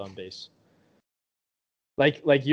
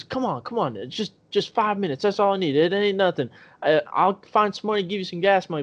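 higher-pitched, wheedling retold plea; mock pleading; 5 semitones higher than usual. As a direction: imitate her begging, voice higher. Retold woman begging for a ride.

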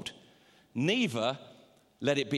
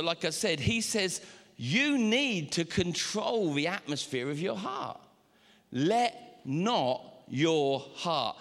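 ordinary talk

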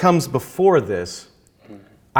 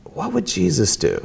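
mildly raised, earnest asking; earnest self-question; 4 semitones higher than usual. Retold or imitated. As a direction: put the question to yourself. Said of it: "literally saying".

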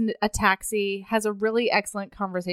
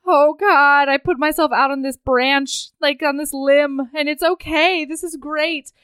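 flustered excitement; 5 semitones higher, 5 dB louder than usual. Retold or imitated. Voicing her nervous relief.

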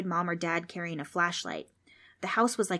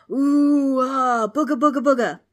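loud, high, wavering ghost howl; spooky ghost wail; 6 semitones higher, 10 dB louder than usual. A narrator's reading. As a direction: wail to scare the kids. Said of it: "The ghost started flying around".

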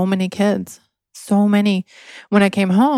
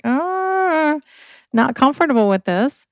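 uneasy younger self; 7 semitones higher than usual. Retold or imitated. Teen girl uneasy with priest.

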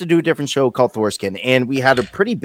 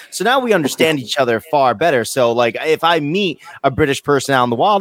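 normal talking voice

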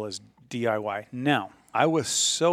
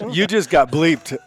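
laughing; 4 semitones higher, 8 dB louder than usual. Real, not acted. Joking about cutting the recording.

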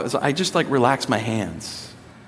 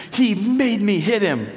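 put-on higher, slow, half-laughing little girl's complaint; a pouting kid blaming her brother; lightly voiced indignant little girl; imitating upset daughter; 6 semitones higher, slower than usual. Daughter explains the fight.

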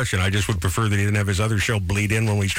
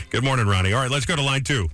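usual speaking voice

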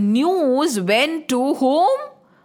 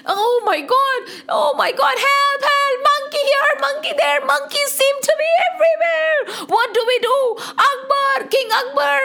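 panicked shouting; 14 semitones higher than usual. Acted. Citizens shouting to King Akbar, very high frantic panic. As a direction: shriek like a terrified crowd. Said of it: "the citizens shouted".

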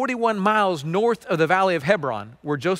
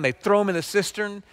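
ordinary talk, nothing marked

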